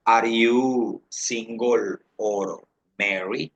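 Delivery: very slow, word-by-word question; slow model question. Shown as a demonstration. Teacher asks students about marital status, very slowly word by word. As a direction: stretch each word for learners.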